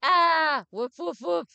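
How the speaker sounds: loud high startled dog barks; mock woofs from a dog startled by the bell; barking. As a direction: bark like a spooked little dog.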